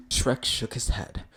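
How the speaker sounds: ordinary delivery, nothing marked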